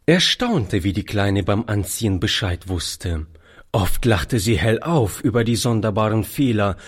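ordinary delivery

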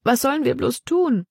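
high-pitched, helpless lament; wailing. A narrator's reading. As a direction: whine the question plaintively. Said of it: "jammerte Libby".

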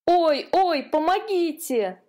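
frightened cry. A narrator's reading. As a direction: yelp as the net catches you. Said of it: "воскликнул он".

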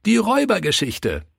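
put-on high-pitched eager child; eager children's call. A narrator's reading. Children asking Pastor for a story, calling out eagerly.

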